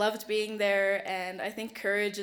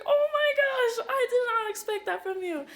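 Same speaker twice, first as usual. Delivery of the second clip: very high, laughing mock astonishment; loud, squeally retold surprise; startled exclamation; 6 dB louder than usual. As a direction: imitate someone taken aback, pitch shooting way up. Voices nominator startled by her crying.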